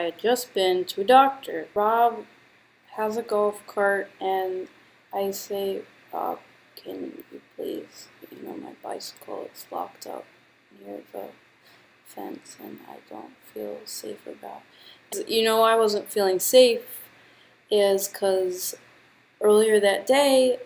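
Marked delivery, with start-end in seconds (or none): 6.1-15.3 asking her friend Rob for a favor in a whisper; sore-throat whisper. Retold or imitated.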